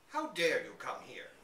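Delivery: angry master of the house catching an intruder; furious. A narrator's reading. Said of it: "asked Van Shiel furiously".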